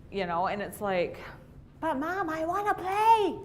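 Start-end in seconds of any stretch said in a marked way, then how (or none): 1.8-3.4 put-on whiny kid voice. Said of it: "kid asks Mom".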